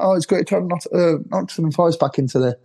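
high-pitched gushing welcome; mock welcoming. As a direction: imitate fans cooing over a returning club. Mimics everybody welcoming the promoted club.

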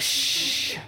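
long, airy "shh"; drawn-out voiceless hush. A narrator's reading. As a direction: shush them with a sustained breath. Sloan hushes the listeners.

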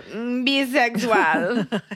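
slow Mm, bisexual copied from the app, sliding up at the end; imitating the app voice. Mocking the translation app's voice, slow drawn-out imitation. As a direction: drag out Mm, bisexual like the recording. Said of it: "she put an M before".

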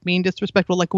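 ordinary delivery

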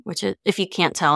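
ordinary talk

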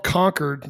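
Concord said like "conquered"; local pronunciation. Shown as a demonstration. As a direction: Concord with a squashed, unstressed second syllable. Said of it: "Most people see it, they're like".

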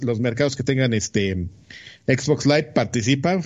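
ordinary talk, nothing marked

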